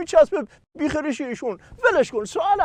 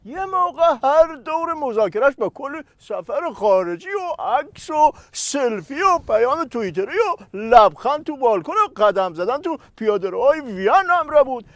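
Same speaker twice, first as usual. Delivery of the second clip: ordinary delivery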